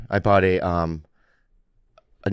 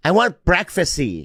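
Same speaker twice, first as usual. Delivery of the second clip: whiny date voice; 7 semitones higher, slower than usual. Retold or imitated. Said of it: "she was like".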